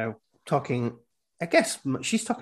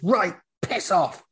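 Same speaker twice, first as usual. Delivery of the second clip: slow, louder, higher warning; slow threatening order; stern warning; 7 semitones higher, 4 dB louder, slower than usual. Retold or imitated. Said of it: "he goes".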